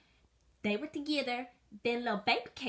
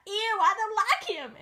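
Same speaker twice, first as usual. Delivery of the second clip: high disgusted; 11 semitones higher, 5 dB louder than usual. Acted. Girl voiced disgusted.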